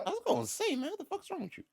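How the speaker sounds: mock outrage. Real, not acted. Joking outrage at friend.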